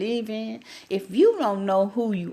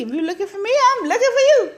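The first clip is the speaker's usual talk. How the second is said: excited; 10 semitones higher, 4 dB louder, much faster than usual. Real, not acted. Excited punchline of her motto.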